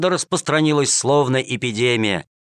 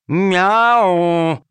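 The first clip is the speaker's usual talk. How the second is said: louder, higher meow like a cat; 7 semitones higher, 4 dB louder than usual. A narrator's reading. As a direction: meow back at them like a cat. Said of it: "снова промяукал в ответ Калимер".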